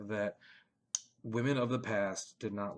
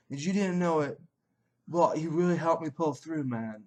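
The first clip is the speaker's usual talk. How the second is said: light mock Australian accent, pitched higher; gushing fan, lightly put-on Australian accent; 5 semitones higher than usual. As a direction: read the fan note in a hammed-up Australian accent. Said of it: "I don't know what accent that is".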